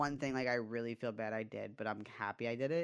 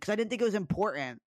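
higher, louder imitation of an excuse; retold defensive protest; 8 semitones higher, 6 dB louder, faster than usual. Imitating girlfriend explaining the hidden message, defensive. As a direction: mimic a defensive girlfriend, voice pushed up.

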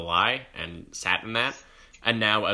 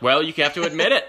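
loud, fast, insistent demand; mock demanding sub; 8 semitones higher, 7 dB louder, faster than usual. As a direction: push the student to confess. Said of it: "She was like".

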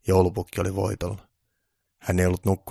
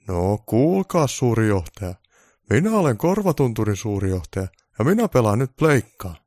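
defiant Santa; 3 semitones higher, 6 dB louder than usual. Acted. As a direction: throw his title back at him. Santa defies the boss.

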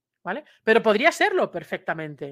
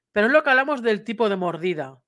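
normal talking voice

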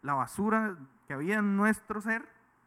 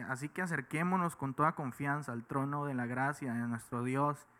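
scripture reading; 4 semitones lower than usual. Acted. Reads Hebrews 4:16 aloud.

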